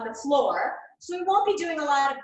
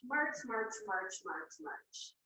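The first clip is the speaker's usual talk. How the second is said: fading to a whisper; 3 semitones lower, 11 dB quieter, slower than usual. Shown as a demonstration. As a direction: chant getting softer to a whisper. Cueing a march, voice fading.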